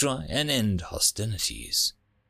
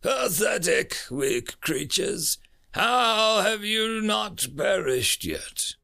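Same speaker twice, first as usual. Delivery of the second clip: high, sneering alien guard, slow; disgusted scoff; 9 semitones higher, slower than usual. Acted. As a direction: spit the words out with contempt. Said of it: "The Telerock guard scoffed in disgust".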